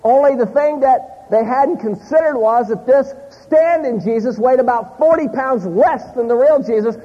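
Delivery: ordinary delivery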